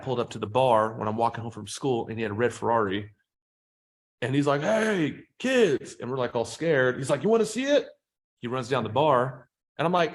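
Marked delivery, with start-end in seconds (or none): 4.6-6.0 put-on high, drawn-out creepy "hey kids"; high mock stranger greeting. Man with a Ferrari calling to the kids, high and sly. Car owner voiced in story. 7.2-7.9 high, sly invitation; mock stranger offer. Man with a Ferrari tempting kids, high sly voice. Car owner voiced in story.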